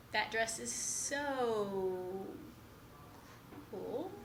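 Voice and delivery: "so" hugely drawn out, pause before "cool"; slow, lingering stress on "so"; emphasis on SO. Shown as a demonstration. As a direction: stretch "so" very long, then leave a gap. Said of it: "words are being emphasized".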